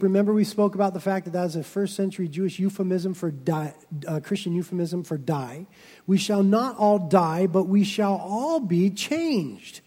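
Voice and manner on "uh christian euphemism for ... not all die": normal talking voice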